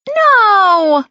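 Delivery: high, loud half-hearted protest; half-hearted cry. Acted. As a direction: whine no, but without conviction. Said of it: "Bella cries, but it's really half-hearted".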